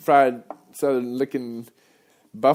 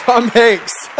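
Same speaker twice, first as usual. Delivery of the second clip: loud, high exclaiming correction of the name; 12 semitones higher, 10 dB louder than usual. Real, not acted. Correcting a slip on stage with a loud high shout. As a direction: blurt the name out big and high.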